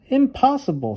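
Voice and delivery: Little Bear reassuring his friend, high and sure; Little Bear's voice. Acted. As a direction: brush the worry away in a high bear voice. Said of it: "said Little Bear".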